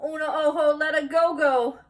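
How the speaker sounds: usual speaking voice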